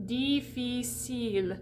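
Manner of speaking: Difficile said slowly, each i a closed ee; model pronunciation. Shown as a demonstration. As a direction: lead the class through Difficile slowly. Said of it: "Say it with me".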